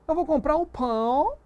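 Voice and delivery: high-pitched mock sentence, "pão" stretched to an O; wrong O ending. Shown as a demonstration. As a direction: end "pão" on a rising, overdone O. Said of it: "Não, você diz pão". ("pão" com O final errado, em tom agudo)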